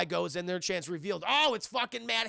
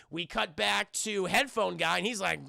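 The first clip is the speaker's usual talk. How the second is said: usual speaking voice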